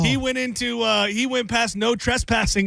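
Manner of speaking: imitating a kid telling on his uncle to his parents; tattling nephew. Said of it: "telling his parents".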